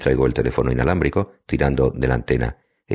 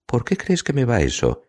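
slow, high-pitched flirty question; lightly flirtatious, pitched well up; coquettish; 11 semitones higher than usual. A narrator's reading. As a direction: ask with playful, genuine interest, drawn out. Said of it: "la pregunta es coqueta y parece auténticamente interesada".

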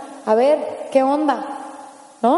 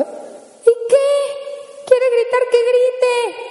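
much higher, slow and indulgent; easygoing relative; 9 semitones higher, much slower than usual. Acted. Relative shrugging off a yelling child, much higher and slow. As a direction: shrug it off, let the kid yell. Same voice, answering husband.

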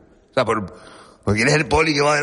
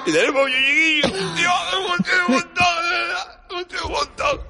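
mock shout; 13 semitones higher than usual. Acting excited over years together.

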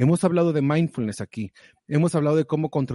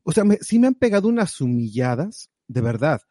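usual speaking voice

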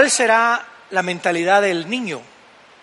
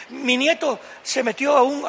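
usual speaking voice

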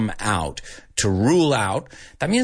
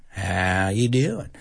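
slow, dragged-out Southern drawl; 3 semitones lower, slower than usual. Shown as a demonstration. Teacher demonstrating Southern vowel length, slow Southern drawl. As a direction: stretch "How you doing" long, Southern style. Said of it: "they drag 'em out a little bit more".

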